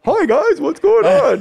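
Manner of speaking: very high, squeaky hello; mock-chirpy greeting. College kid greeting locked-up gang kids, mock-chirpy and high. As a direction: pitch it way up, parodying your naive self.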